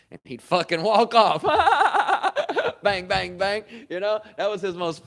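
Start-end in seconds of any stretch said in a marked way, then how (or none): 1.5-2.8 loud high burst of laughter. Real, not acted. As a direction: crack up hard. Laughing at the memory.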